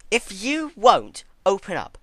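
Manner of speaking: slow, loud, high furious threat; angry threat. Acted. Twilight angered as Celestia shuts her mouth, furious. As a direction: snarl out the threat. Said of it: "immediately angered".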